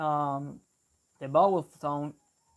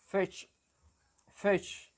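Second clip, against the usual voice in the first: FISH with a shifted New Zealand i, higher; New Zealand accent; 4 semitones higher than usual. Shown as a demonstration. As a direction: model New Zealand 'fish', one word alone. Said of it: "but in New Zealand, they say".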